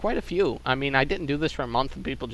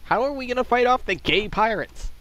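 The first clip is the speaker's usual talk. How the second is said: mock panic; 9 semitones higher than usual.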